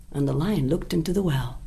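usual speaking voice

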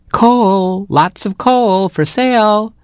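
high, slow hawker's call; seal crying wares. A narrator's reading. Seal hawking coal from his bobsled, high slow sales cry. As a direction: call out the coal like a street seller. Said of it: "he cried".

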